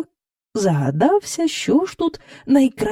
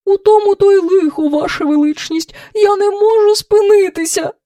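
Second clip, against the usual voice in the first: high, louder plaintive wail; 11 semitones higher, 5 dB louder than usual. A narrator's reading. Red cow telling the king she cannot stop, plaintively. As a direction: lament helplessly in a high voice. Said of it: "жалібно промовила руда корова".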